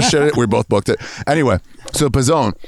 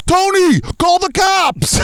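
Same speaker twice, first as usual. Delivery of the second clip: high, slow gasp-filled scream-call; hammed-up panic; much slower than usual. Law and Order jogger finds a body, high hammed-up panic. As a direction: gasp then cry for help. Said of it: "we're like".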